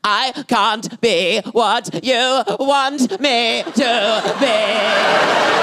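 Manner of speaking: slow, high hammed-up little-boy wail; talking while sobbing. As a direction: sob word by word, stretching the last into a wail. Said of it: "talking and sobbing at the same time".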